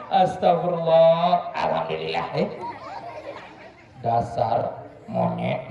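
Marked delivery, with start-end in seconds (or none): 4.0-5.7 low, slow, breathy-laughing puppet jibe; cheeky puppet insult. Acted. Puppet Ucup teases his handler, low slow laughing. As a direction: drag it out, low and giggling.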